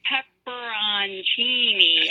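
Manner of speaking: PEPPERONCINI stretched out syllable by syllable; very slow. Retold or imitated. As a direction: imitate someone saying "Pepperoncini" very slowly for a confused listener. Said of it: "say it again, slower".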